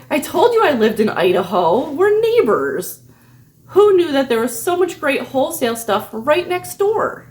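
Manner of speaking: bright, high mock cheer, slowed on We're neighbors; mock excited. To the scammer, a mock excited reply. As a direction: play up the fake neighborly delight. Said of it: "So I say".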